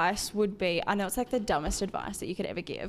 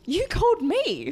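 slow, high bewildered protest; baffled, drawn out; confused disbelief; 10 semitones higher, 5 dB louder, slower than usual. Retold or imitated. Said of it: "And I was like".

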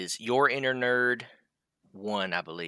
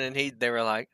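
normal talking voice

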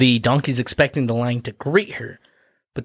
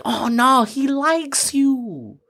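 high-pitched, drawn-out reassurance; coaxing rabbit; 10 semitones higher, slower than usual. Acted. Rabbit reassuring the jilted donkey, high cooing drawl. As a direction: coo it high and stretch the words out.

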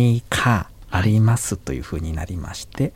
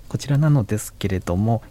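usual speaking voice

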